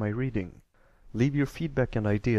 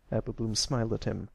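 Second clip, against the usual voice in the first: ordinary delivery, nothing marked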